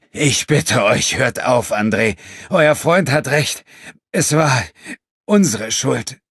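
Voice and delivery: slow, high, shaky pleading; trembling voice. A narrator's reading. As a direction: beg through a trembling voice in pain. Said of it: "mit zitternder Stimme fortfuhr".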